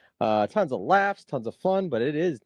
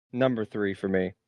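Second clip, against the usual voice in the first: usual speaking voice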